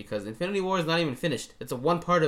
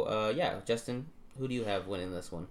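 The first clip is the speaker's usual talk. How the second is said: ordinary delivery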